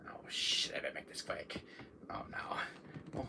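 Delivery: hushed panicked whisper. Acted. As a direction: whisper fast so nobody hears.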